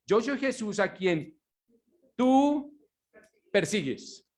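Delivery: slow, with tú lifted high and leaned on; pointed accusing tú; stress on tú. Acted. Voicing Jesus to Saul.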